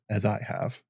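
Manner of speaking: soft, hushed repeat; whisper. Acted. Old maester ending his tale to John, hushed. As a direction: let it fall almost to a whisper. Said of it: "His voice fell to a whisper".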